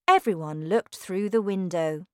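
usual speaking voice

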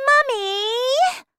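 high, piercing yell for mother; loud, high bratty call; spoilt child's shout. Acted. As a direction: shriek like a demanding little girl. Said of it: "shouted Veruca".

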